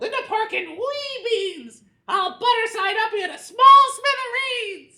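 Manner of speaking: high, slow, loud threat; villain yell. Acted. Vanitch threatens grandpa on the wall, high slow yell. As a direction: bellow it with glee. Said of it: "he yelled".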